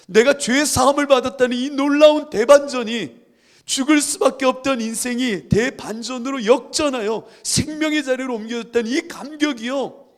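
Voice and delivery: loud, high-pitched sermon delivery; impassioned preaching. Acted. Preacher telling congregation of the great reversal, impassioned. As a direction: preach it out with soaring conviction.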